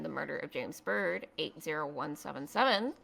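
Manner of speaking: ordinary delivery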